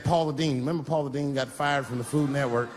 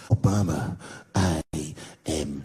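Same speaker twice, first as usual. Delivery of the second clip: low, menacing mock terrorist voice, much lower; ISIS executioner voice; 12 semitones lower, slower than usual. Comic mocking a beheading video in a deep villain voice. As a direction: growl it down low like a masked executioner. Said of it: "He looked right in the camera and said".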